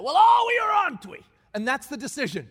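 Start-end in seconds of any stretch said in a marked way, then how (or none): 0.0-1.2 high, loud rallying cry; defiant challenge. Retold or imitated. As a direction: throw the challenge at the crew. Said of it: "Just like that".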